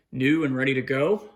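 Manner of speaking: ordinary delivery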